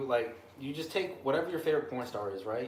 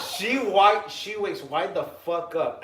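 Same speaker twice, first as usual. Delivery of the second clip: ordinary talk, nothing marked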